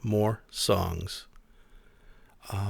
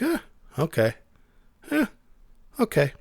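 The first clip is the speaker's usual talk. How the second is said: unenthusiastic; 4 semitones higher than usual. Retold or imitated. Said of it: "It's like".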